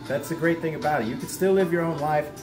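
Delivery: ordinary delivery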